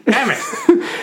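high, squeaky exclamation; Mister Bean impression. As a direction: squeak it out like a clumsy comic character. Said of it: "What about Mister Bean?".

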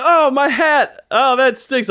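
high, wailing mock lament; mock dismay. Joking with a stranger about his wet hat, mock dismay. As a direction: overplay the loss, drawing it out high. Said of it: "joking around".

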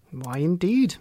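plain reading voice

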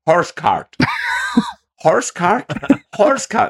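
high, baffled American repeating the words; confused Zach Bagans. Retold or imitated. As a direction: play him not getting it at all. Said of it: "Zach Bagans is going".

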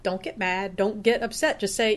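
ordinary talk

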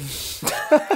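real laugh; high breathy laugh. Real, not acted. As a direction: laugh it off in disbelief. Laugh after quoted complaint.

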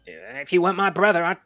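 put-on high, loud squawky old-man voice; bickering old man. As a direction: rise into a shrill spluttering rant. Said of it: "you put on a different voice".

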